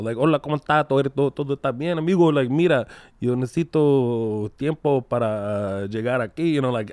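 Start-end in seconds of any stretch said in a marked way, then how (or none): 0.2-2.3 lively Spanish in a Mexican accent. Shown as a demonstration. Guest showing how he becomes Mexican speaking Spanish, Mexican accent. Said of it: "speaking to them in Spanish". 2.5-6.5 slow Mexican-accented Spanish. Shown as a demonstration. Guest showing how he talks to Mexicans, slow Mexican-accented Spanish. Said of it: "speaking to them in Spanish".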